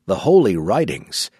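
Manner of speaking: plain reading voice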